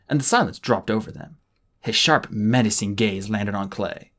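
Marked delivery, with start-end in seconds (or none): none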